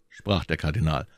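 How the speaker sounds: ordinary delivery, nothing marked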